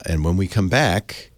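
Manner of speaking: ordinary talk, nothing marked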